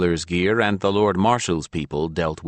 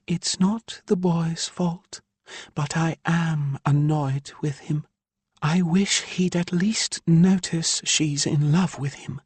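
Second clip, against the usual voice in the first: higher, slower mother's voice, irked; higher woman's voice, aggrieved; Ilya's voice; 11 semitones higher, slower than usual. A narrator's reading. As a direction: voice the exasperated mother Ilya. Ilya annoyed with Lavin.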